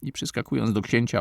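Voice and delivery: ordinary delivery, nothing marked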